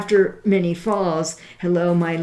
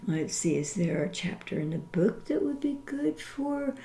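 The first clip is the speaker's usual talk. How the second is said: whispering; 8 dB quieter, faster than usual. Real, not acted. Aside while searching the book.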